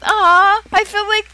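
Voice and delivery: overwhelmed with delight at a gift, squealing thanks; high-pitched, giddy gratitude with bursts of laughter; excited gushing. Real, not acted. Thanked for a first Super Chat.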